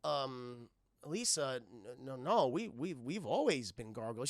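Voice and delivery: low, halting correction; Goliath. Retold or imitated. Goliath correcting confused Elisa, low hesitant acting. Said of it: "He's like".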